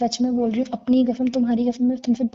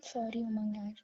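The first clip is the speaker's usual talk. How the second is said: much softer, barely voiced sorry; hushed apology; 15 dB quieter than usual. Girlfriend apologising to Umang after hearing about Aryan, hushed and soft. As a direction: drop almost to nothing as you apologise.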